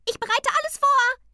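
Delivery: very high, loud creature squeal; calling Tingeli. A narrator's reading. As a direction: shout it excitedly in a tiny voice. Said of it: "rief das Tingeli". (laut gerufen, hohe Piepsstimme)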